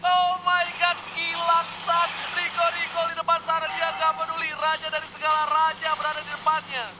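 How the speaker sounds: high-pitched hype call; excited play-by-play. Real, not acted. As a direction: cast the dive with rising excitement. Caster hypes Sans dive.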